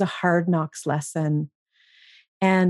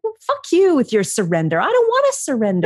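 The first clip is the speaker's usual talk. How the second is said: imitating her own outburst, pitched much higher; retold fury; 7 semitones higher than usual. Retelling how she raged at the idea of surrender, with high mock fury. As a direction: spit out the refusal, half playing it up. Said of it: "And I got so irritated".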